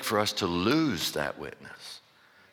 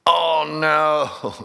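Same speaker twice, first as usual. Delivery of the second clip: dismayed cry; 6 semitones higher, 5 dB louder than usual. Retold or imitated. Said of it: "and I'm like".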